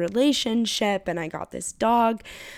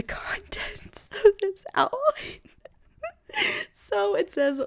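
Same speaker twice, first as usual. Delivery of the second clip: giggling through the words, breaking up high; can barely read it for laughing; 13 semitones higher than usual. Real, not acted. Podcaster reading her sparse outline, laughing high.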